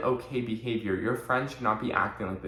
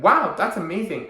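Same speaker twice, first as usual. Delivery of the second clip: higher and louder cheer for a friend; congratulating a friend who got ahead, excitedly; excited praise; 8 semitones higher, 6 dB louder than usual. Retold or imitated. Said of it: "Be like".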